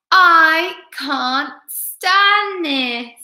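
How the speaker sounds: very slow, high, loud wail; exasperated bear. A narrator's reading. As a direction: lead the bear's drawn-out refrain. Bear refrain, all together.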